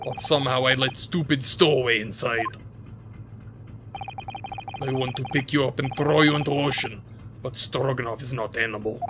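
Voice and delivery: put-on gruff Russian-accented guard, proud; guard showing off the first-class cabins, Russian accent. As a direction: boast like a self-important Russian guard. Sailor voice.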